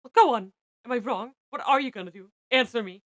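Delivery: loud, demanding reprimand; angry scolding. A narrator's reading. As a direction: press hard for an answer, loudly. Scolder berates the kneeling narrator.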